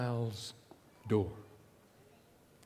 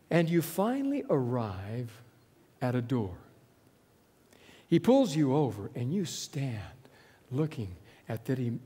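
ordinary talk

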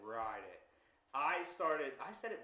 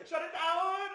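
laughing; 8 dB louder than usual. Real, not acted. Echoing joke, laughing.

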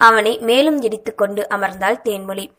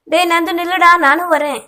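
higher, louder call after him; 8 semitones higher, 3 dB louder than usual. A narrator's reading. Young woman calling after the running boy, loud and high. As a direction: shout after the boy to wait.

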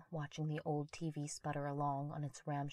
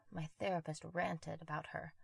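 ordinary delivery, nothing marked

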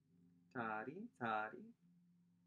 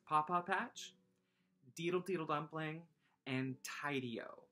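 ordinary delivery

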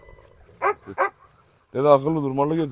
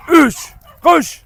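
excited shout; 12 semitones higher, 8 dB louder than usual. Real, not acted. Ball throw count.

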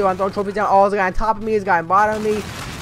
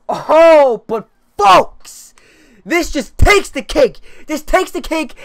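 hyped intro; 6 semitones higher, 6 dB louder, much slower than usual. Real, not acted. Hyping up an awful game.

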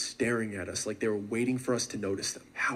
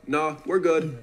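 quick, louder and higher brush-off; blurted out; 4 semitones higher, 6 dB louder, slower than usual. Retold or imitated. Hiker refusing the cult leader's offer, blurting loudly. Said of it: "I just blurt out".

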